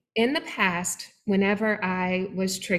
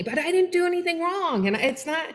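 higher, pleading mock protest; whiny protest; 10 semitones higher than usual. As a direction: imitate a husband insisting he did nothing wrong. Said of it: "just fighting me".

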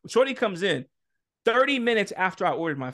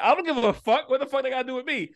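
retold angry outburst, pitched up; going off; 6 semitones higher than usual. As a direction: fire back indignantly, as if re-living it. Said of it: "I was just going off".

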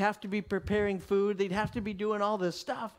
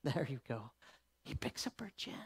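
hushed whisper; 4 semitones lower, 9 dB quieter than usual. A narrator's reading. Tender narration of Jesus.

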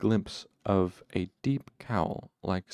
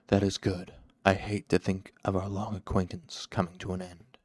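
cool dark master, veiled threat; a measured, lightly voiced dark lord; cool shadowed lord. Shadowed man warns his servant Cinder, coolly menacing.

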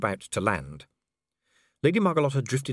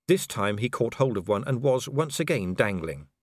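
usual speaking voice

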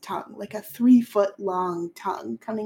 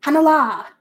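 Hanala higher and louder with a falling end; 6 semitones higher, 8 dB louder than usual. Shown as a demonstration. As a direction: declare Hanala boldly. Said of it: "say it a little bit louder".

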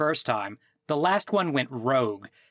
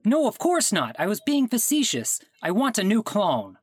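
exasperated; 6 semitones higher than usual. Acted. Caller snaps back, exasperated.

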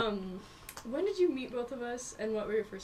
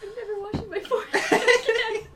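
giddy squeal; 12 semitones higher, 10 dB louder, slower than usual. Retold or imitated. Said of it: "We're just like".

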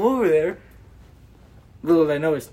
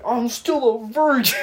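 mock sad; 7 semitones higher, 3 dB louder than usual. Retelling a drunk friend's lament, mock sad. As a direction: wail it in comic self-pity. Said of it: "all sad".

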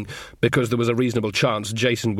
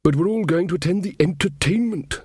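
Verence; 7 semitones higher than usual. Acted. King Verence speaking in story.

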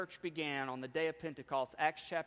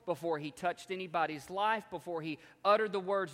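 usual speaking voice

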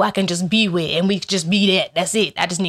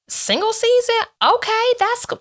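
much higher, playfully surprised; mock surprised delight; 14 semitones higher than usual. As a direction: squeal it like good news. Reacting to being single.

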